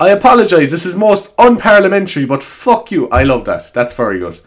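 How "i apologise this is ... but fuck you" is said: lightly voiced politician, pitched higher; a hint of parliamentary apology turning to insult; Irish politician impression. Politician apologising for unparliamentary language, lightly imitated. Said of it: "Irish politicians acting like that".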